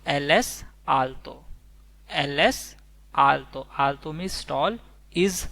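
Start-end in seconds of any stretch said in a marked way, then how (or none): 0.1-3.7 slow, drawn-out Spanish model phrase; slow careful Spanish. Shown as a demonstration. Teacher modelling Spanish for students, slowly and clearly. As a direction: spell it out slowly for learners.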